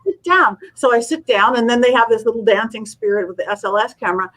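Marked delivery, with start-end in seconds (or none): 0.0-0.6 retold yelling. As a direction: snap it out twice, urgently. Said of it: "but he's yelling at me".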